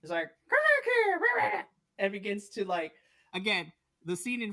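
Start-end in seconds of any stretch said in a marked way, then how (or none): 0.5-1.6 much higher, louder crying yell; a tiny creature crying out after the spider; squeaky shout. Acted. Squeak Squad wailing after the chasing spider, much higher.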